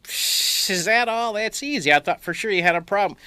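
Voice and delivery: mock-shocked, high and sarcastic; scoff, then feign surprise; teasing the worried dad with mock shock. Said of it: "Shocked, I said".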